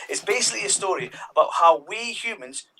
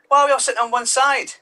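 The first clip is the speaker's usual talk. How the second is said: puzzled disciple; 9 semitones higher, 6 dB louder than usual. Acted. Disciple asks at Last Supper.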